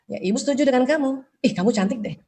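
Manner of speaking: soft, gentle mother's encouragement; gentle praise. Retold or imitated. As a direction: softly reassure your child. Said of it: "Ini contoh-contohnya nih".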